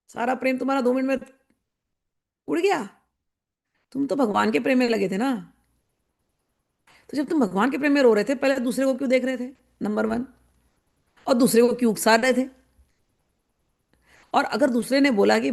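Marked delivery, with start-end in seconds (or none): none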